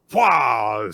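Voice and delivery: battle cry. Retold or imitated. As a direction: grunt the attack yell.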